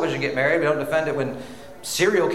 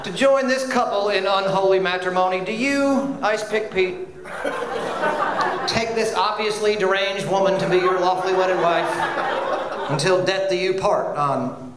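mock officiant. Mock death row wedding officiant.

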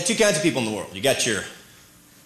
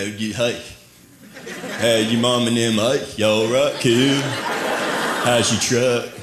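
mock Texas drawl, lower; lightly put-on Southern twang; playful Texan voice; Southern accent; 5 semitones lower, slower than usual. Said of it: "I talk like ... people in whatever region".

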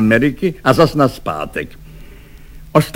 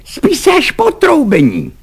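high-pitched officer's scolding; angry reprimand. A narrator's reading. Lieutenant scolds a soldier caught napping, high angry reprimand.

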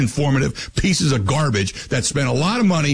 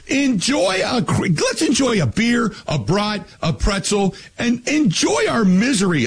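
excited shout; 8 semitones higher, slower than usual. Real, not acted. Host rants, urging fans to relax.

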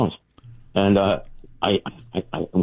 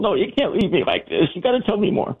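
pleading; 13 semitones higher than usual. Retold or imitated. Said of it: "And I'm going".

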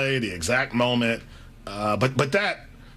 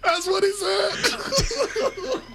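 high, slow words stretched through laughter; cracking up mid-sentence; laughing hard; much slower than usual. Real, not acted. Co-host laughing at his own impression, high and slow. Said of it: "That's how he sounded".

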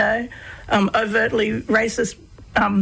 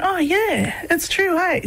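higher, bright realisation; sudden dawning, pitched up; light bulb moment; 8 semitones higher, slower than usual. Retold or imitated. Boy realises his dad is right, pitched-up sudden realisation. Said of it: "light bulb moment".

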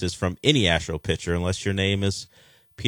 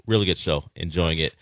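ordinary delivery

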